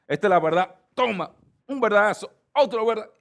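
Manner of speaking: landing verbal blows, loud and high; mock attack. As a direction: hurl each phrase like a punch, pitch climbing. Acts out hitting people with truth. (como dando golpes con las palabras)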